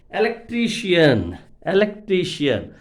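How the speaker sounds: loud "electrician" with TRI hit hard; stress on TRI. Shown as a demonstration. Teacher introducing second example to students, loud stress on TRI in "electrician". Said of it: "Suffix before syllable we have to stress".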